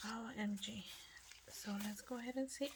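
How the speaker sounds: quick hushed whisper. Real, not acted. Crafter opening the next item in a whisper. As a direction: murmur it as you peek in.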